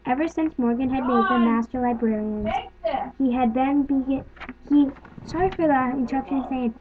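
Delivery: ordinary delivery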